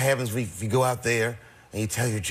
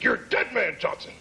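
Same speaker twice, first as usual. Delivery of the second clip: a lightly raised, half-joking tough-guy threat; mock threat; 7 semitones higher than usual. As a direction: play a prank caller, hint of menace. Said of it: "It's like".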